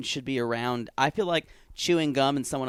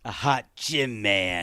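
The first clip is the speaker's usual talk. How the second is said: lower, slow put-on voice; mocking echo; 6 semitones lower, slower than usual. Host echoing Darcy's hot gym man line, mocking. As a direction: drag out the echoed phrase, lower.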